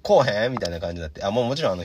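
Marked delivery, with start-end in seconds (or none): none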